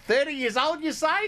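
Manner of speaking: high, hammed-up Aussie accent; squeaky put-on Aussie voice; mock Australian. Host teasing co-host in a high mock Aussie accent.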